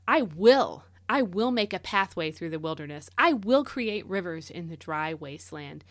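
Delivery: "will" punched loud at the first "I will"; stress on will. Shown as a demonstration. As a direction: lean hard on every "will". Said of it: "put the emphasis on the word will".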